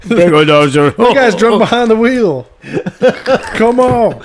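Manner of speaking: loud laughing trucker CB voice; goofy trucker on the radio. Pretend. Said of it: "if I was a trucker on a C B".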